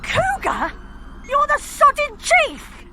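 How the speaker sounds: high, slow stunned disbelief; shocked. Acted. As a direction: gasp it out in disbelief. Shocked at betrayal.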